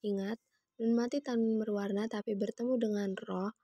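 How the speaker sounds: ordinary delivery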